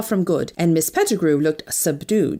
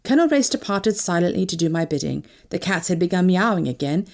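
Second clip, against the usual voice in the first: ordinary delivery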